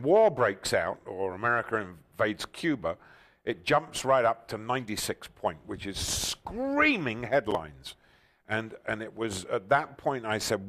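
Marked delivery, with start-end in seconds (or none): 6.0-7.2 SCREAMING stretched out slowly and pitched up; stress on SCREAMING. Shown as a demonstration. Host explains tabloid headlines, SCREAMING drawn out high. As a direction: draw out the word SCREAMING like a giant headline. Illustrating his headline delivery style.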